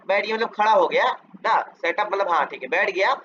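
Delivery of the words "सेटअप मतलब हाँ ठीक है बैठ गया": usual speaking voice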